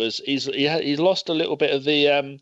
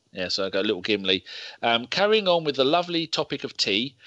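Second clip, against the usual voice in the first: usual speaking voice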